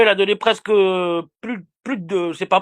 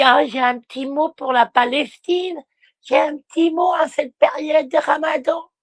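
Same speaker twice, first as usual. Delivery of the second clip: mocking Sophia's live voice; 6 semitones higher than usual. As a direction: parody her pious little speech. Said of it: "tu nous dis".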